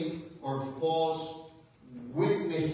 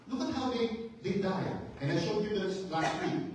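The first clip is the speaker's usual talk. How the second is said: usual speaking voice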